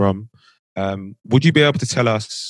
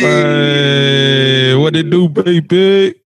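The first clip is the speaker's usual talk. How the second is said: long drawn-out "hey", rising higher; hyped greeting; 4 semitones higher, 4 dB louder, much slower than usual. Real, not acted. Host hails the guest joining the call, hyped greeting. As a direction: hail a friend with a stretched, climbing call.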